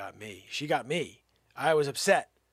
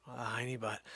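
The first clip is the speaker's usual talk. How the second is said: usual speaking voice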